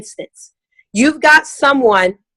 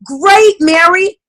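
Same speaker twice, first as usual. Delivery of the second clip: delighted consultant; 7 semitones higher, much slower than usual. Acted. Consultant responds to keen guest.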